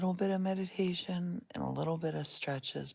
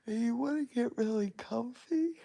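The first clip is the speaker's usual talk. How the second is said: words stretched by a yawn; 8 semitones higher, 6 dB louder than usual. Real, not acted. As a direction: talk through a yawn. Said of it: "Excuse me, you might yawn".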